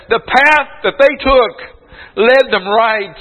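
preaching. Real, not acted. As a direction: pour out the testimony with fervour. Fervent preaching, retold prayer.

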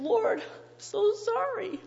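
imitated weeping plea, pitched well up; tearful pleading. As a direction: beg for mercy, acting out Peter's bitter regret. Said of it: "must have been weeping bitterly and saying".

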